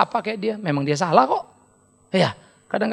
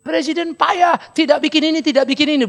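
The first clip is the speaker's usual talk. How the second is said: higher, complaining critic; mock critic; 6 semitones higher than usual. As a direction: whine about the president. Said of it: "Orang yang mengkritik presiden". (pengkritik yang mengeluh)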